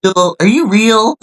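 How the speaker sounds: high teasing question; mock suspicious. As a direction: tease like quizzing a robot.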